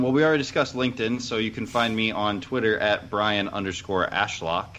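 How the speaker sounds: ordinary delivery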